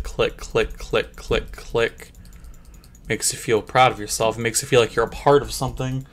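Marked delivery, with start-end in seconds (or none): 0.0-2.1 soft whisper, trancelike; whispered clicks. Acted. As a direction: murmur as if hypnotized. Hypnotized murmured clicking.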